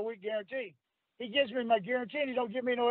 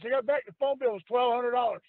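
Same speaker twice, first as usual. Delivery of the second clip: ordinary talk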